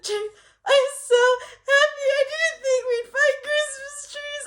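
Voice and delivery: high, teary voice choked with joy; crying happy tears. Retold or imitated. Wife crying with joy to Jake over finding trees, teary and high. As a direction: let the relief break your voice into tears. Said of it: "I cried".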